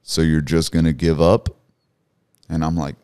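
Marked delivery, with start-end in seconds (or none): 0.0-1.5 big booming voice of God; loud, commanding divine challenge; deep, majestic voice. Retold or imitated. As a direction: boom it out like the Creator, much louder. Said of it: "in this very deep, majestic voice".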